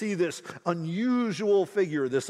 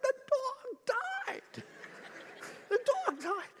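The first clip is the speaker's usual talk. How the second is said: much higher, softer whimper through a laugh; laughing mock sob; 5 dB quieter than usual. Telling the man on the plane why he's crying, tearful laughing whimper. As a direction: wail it like a heartbroken kid. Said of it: "And I'm like".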